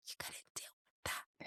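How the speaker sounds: urgent-paced, very soft whisper; quick hushed whisper; acted whisper. Acts out a hushed exchange.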